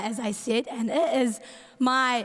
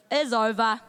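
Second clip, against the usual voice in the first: normal talking voice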